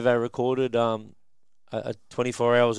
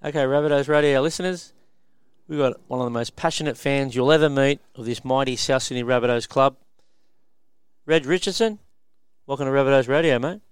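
upbeat, louder radio host intro; radio announcer; 3 semitones higher, 6 dB louder than usual. Acted. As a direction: welcome listeners like a radio host. Host opens recorded radio interview.

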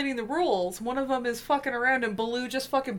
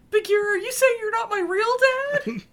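mock betrayed son; 11 semitones higher, 5 dB louder, faster than usual. Voicing Mowgli as a betrayed son.

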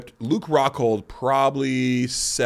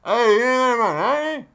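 high, loud call across a noisy hot tub, far higher than his own voice; calling guy impression; 12 semitones higher, 6 dB louder than usual. Hot tub guy calls out to him in the sauna, high and loud. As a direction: shout it over the hot tub noise to get a stranger's attention. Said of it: "someone looking in my direction going".

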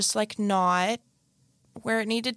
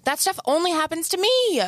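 exasperated complaint; 7 semitones higher than usual. Real, not acted. Exclaiming over own embarrassing mishaps.

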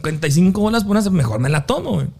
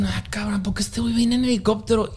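hushed, quick puzzled whisper; whispered wonder; 7 dB quieter than usual. Retold or imitated. Fan puzzling over the helicopter, whispered. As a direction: murmur it under your breath. Puzzled onlooker thinking aloud.

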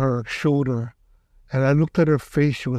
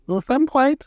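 higher, bright well I'm fine; higher, mock-chirpy reassurance; cheery student; 10 semitones higher than usual. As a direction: brag lightly that Sensei fixed her. Healed student boasting to thrower.

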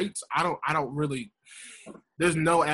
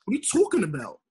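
imitated Long Island girl; put-on Long Island vowels; Long Island accent; 3 semitones higher than usual.

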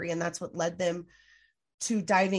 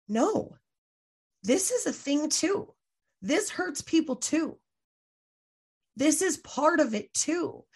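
higher, slow, each "too" pressed home; insistent retold protest; 7 semitones higher, slower than usual. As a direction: plead slowly that this counts too. Insisting MLM harm matters too.

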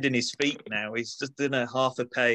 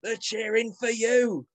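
put-on Manchester accent; 5 semitones higher than usual. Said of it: "in my best Manchester accent".

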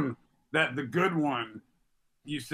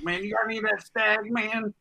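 higher, jittery street hustler voice; strung-out crackhead; 6 semitones higher than usual. Retold or imitated. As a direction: beg like a twitchy crackhead. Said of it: "looked like that crackhead on the Chappelle Show".

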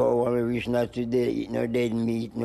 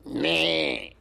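drawn-out, higher goat's "meh"; goat bleat; 4 semitones higher than usual. Retold or imitated. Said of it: "go meh".

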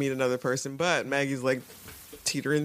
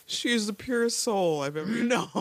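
laughing through quote; 8 semitones higher than usual. Retold or imitated. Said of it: "he says".

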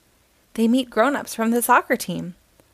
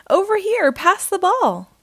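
high, louder call for the ball; 8 semitones higher, 4 dB louder than usual. A narrator's reading. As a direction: shout for a pass across the field. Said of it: "she calls".

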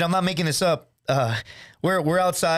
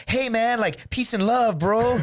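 higher, drawn-out mock hippie voice; fake hippie backpacker; 7 semitones higher, slower than usual. Pretend backpacker greeting hostel guests, mock hippie. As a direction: play a blissed-out backpacker. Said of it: "pretending like we were dirty backpackers".

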